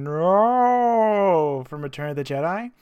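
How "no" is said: loud drawn-out Vader no. Retold or imitated. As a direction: wail a long dramatic no. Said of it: "Darth Vader going".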